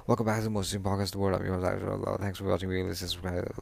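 rattled off at double speed; rapid-fire podcast intro; really fast. Shown as a demonstration. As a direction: race through the intro in one breath. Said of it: "I wanted to say it really fast".

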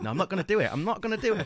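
high, loud mock refusal; playful protest. Host cuts off his own running joke, high loud playful protest. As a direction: pitch jumps up as you stop your own joke.

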